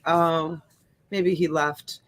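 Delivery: usual speaking voice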